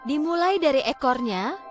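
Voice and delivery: ordinary delivery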